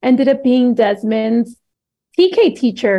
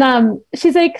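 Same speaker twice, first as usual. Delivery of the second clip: ordinary talk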